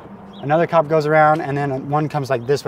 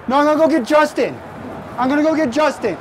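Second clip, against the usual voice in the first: high, raised mock-frantic voice; imitated agitated woman; 11 semitones higher than usual. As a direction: mimic her insisting, pitched way up.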